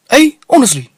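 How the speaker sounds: high, loud and pushy; loud street stranger. Retold or imitated. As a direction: fire the question up high and loud. Stranger doubts him.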